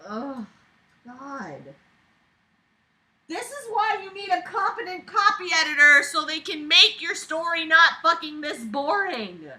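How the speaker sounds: high, loud frustrated rant; exasperated rant. Real, not acted. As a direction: vent at the boring writing. Ranting at the author.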